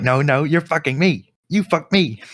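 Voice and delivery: squeaky bratty cartoon protest; Cartman voice. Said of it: "And he's like".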